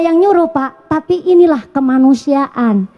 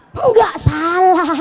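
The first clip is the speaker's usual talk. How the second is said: high, slow put-on voice answering; drawn out like a kindly elder; high drawn-out reply; mock answer; 4 semitones higher, slower than usual.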